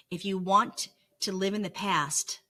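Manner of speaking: ordinary delivery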